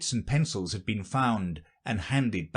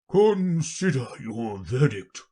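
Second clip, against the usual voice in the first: slow, wavering king; low, trembling voice; 3 semitones higher, 4 dB louder, slower than usual. Acted. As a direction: draw the order out tremulously. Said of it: "in a low, trembling voice".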